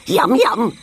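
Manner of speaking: lightly hammed-up delight; gleeful cry. A narrator's reading. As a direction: pipe up eagerly at the sight of food. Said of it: "cried Monkey".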